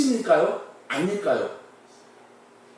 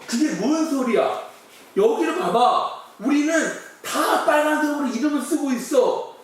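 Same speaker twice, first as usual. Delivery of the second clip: baffled reply; 6 semitones higher than usual. Acted. American baffled, explaining.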